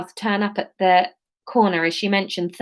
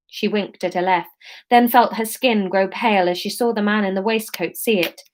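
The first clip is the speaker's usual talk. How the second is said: ordinary delivery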